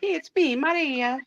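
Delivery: put-on high, playful Italian-accented announcement; bouncy cartoon Italian greeting; Mario-like Italian accent. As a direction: pipe up like an Italian game character. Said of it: "that's what my life sounded like".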